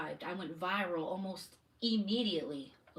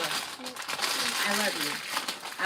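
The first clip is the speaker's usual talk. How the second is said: tender; 3 semitones higher, 7 dB louder, much slower than usual. Real, not acted. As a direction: melt into sweetness after the scolding. Mom softens sending child off.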